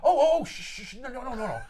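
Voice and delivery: high, hurried mock hushing, starting loud and easing off; flustered shushing. Venom calms the freaking-out mother, flustered. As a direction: shush someone in a mild panic.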